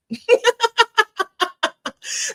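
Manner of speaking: a sudden high burst of laughter. Real, not acted. Laughing at own remark.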